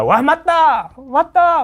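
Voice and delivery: high, fired-up cry of agreement; mock rallying cheer, pitched high; excited agreement. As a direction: shout it like a student roused by a speech. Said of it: "막 이러면서".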